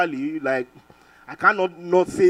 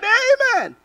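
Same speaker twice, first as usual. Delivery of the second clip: high amen; 5 dB louder than usual. Retold or imitated. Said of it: "I said".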